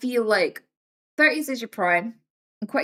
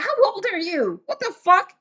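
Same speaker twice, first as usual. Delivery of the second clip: much higher, louder shocked outburst; mock shock; 11 semitones higher, 5 dB louder than usual. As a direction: blurt it out stunned. Strangers shocked at her age.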